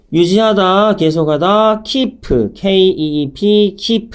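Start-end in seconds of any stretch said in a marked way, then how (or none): 2.5-3.8 slow letter-by-letter spelling; slow teacher spelling. Shown as a demonstration. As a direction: spell out P E E L one letter at a time. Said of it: "spelling the word out".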